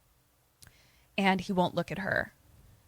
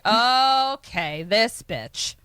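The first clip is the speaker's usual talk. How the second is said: loud, drawn-out higher "okay"; taken-aback reaction; 3 semitones higher, 6 dB louder, much slower than usual. Retold or imitated. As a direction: stretch out an appalled okay. Acts out Daniel's taken-aback reaction.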